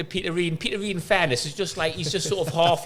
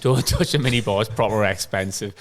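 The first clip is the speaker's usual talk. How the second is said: the warning breaks into a laugh; 7 semitones lower than usual. Retold or imitated. Roommate repeats the minibar warning, laughing. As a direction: laugh through the word touch. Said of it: "me and Con were like".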